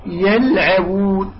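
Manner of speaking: يلعبون slowly, every letter thin; stretched, unrounded يلعبون; careful light recitation of يلعبون. Shown as a demonstration. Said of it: "كلها حروف مستفلة".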